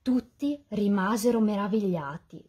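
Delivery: usual speaking voice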